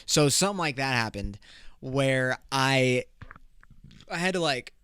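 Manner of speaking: ordinary talk, nothing marked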